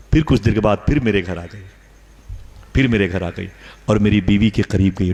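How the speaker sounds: normal talking voice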